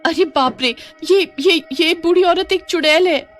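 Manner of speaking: high-pitched, stammering fright; frightened shock. Acted. Mohini seeing the old woman turn witch, frightened. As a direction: stammer in high, acted alarm.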